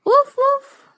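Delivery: high, loud yappy woof; dog bark. Pretend. Teacher imitating dog bark.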